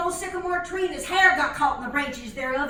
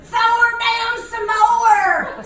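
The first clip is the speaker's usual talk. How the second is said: even higher, louder drawn-out holler; 8 semitones higher, 10 dB louder, much slower than usual. Retold or imitated. Fella hollering up the tower for more, a long loud yell. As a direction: yell it up the tower, stretching every word. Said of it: "He hollered".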